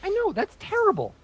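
usual speaking voice

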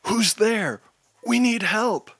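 high, desperate plea. A narrator's reading. Trapped hero begs whoever is there for help, desperate. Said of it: "Rainbow Chaser says desperately".

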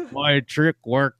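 slow mock-villain monster voice; Shadow Monster. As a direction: gloat slowly like a movie monster. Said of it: "the Shadow Monster's like".

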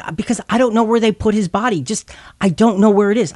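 high, hurried, distraught retelling of Mary's plea; a mild take on a frantic woman who lost her Lord's body; voicing Mary's near-panic lightly, quick and high; overwrought Mary. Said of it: "she is overwrought".

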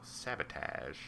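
"Sabotage" with a flat A, sab-uh-TADGE; low, quiet "Sabotage" ending like "badge"; Kirk's pronunciation. Fan wishing Kirk said it, sab-uh-TAZH with flat A. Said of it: "they still haven't made Kirk go".

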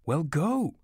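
high, urgent motherly command. A narrator's reading. Mother shoos her son toward the door, commanding. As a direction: order him off, pitched high. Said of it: "she commanded".

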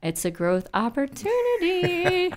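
high, slow forced cheerfulness through teeth; gritted teeth. Shown as a demonstration. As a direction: squeeze it out through clenched teeth. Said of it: "say that through gritted teeth".

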